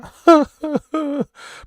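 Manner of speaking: real high, loud giggle; high laugh. Real, not acted. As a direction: burst out in high-pitched laughter. Laughs at own remark.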